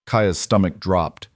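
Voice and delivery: ordinary delivery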